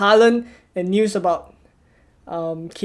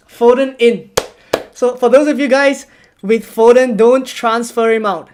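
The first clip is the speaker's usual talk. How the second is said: louder and higher, pressing the viewers; excited urging; 3 semitones higher, 7 dB louder than usual. Real, not acted. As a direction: hype them up, louder, higher. Urging viewers about Foden news.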